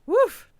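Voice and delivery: sudden loud sneeze; a real sneeze bursting out; sneezing. Real, not acted. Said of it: "Suddenly sneezing".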